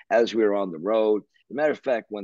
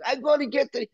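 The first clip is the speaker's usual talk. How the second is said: high-pitched mock yelling. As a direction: imitate someone shouting while being held back. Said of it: "They're tr-, holding him back".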